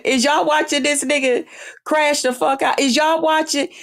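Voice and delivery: gloating taunt. Real, not acted. Gloating at the ex's downfall.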